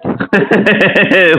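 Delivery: short burst of chuckling; amused laugh. Real, not acted. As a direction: laugh at being found out. Amused by the Netflix accusation.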